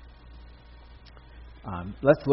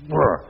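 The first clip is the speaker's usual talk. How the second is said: bear hug grunt; 3 semitones higher, 8 dB louder than usual. Retold or imitated. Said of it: "I can just picture Mary just like".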